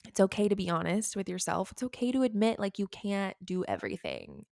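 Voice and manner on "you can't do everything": usual speaking voice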